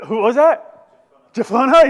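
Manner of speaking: loud, high shout to the room; calling out. Real, not acted. Preacher repeating a congregant's answer, calling out loudly. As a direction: call it out to the back row.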